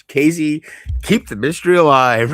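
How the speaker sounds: loud, emphatic self-imitation; own exaggerated talk. Streamer tells his friend to stay mysterious, loud self-imitation. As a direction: boom it out as a mock instruction. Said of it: "You know how I would talk".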